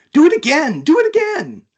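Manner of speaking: very high, loud, gleeful squeal; excited creature squeal. Acted. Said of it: "shook with excitement".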